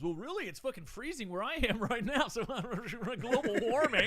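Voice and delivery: high, loud skeptic's mock complaint; scoffing guy. Mocking a global warming doubter, scoffing loudly. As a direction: put on a loud, incredulous naysayer. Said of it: "then the guy goes".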